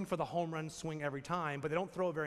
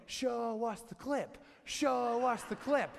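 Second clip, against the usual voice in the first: eager crowd demand; 6 semitones higher, 3 dB louder, slower than usual. Retold or imitated. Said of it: "you're like".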